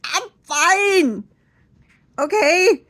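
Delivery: high, loud exasperated snap; mock angry outburst; snapping. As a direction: snap at her to back off. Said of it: "I didn't say that".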